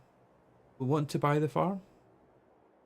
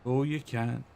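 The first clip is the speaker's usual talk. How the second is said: ordinary talk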